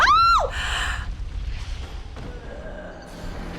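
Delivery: very high, airy shocked shriek; real high gasp of dismay. Real, not acted. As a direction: gasp in horror. Dies in game.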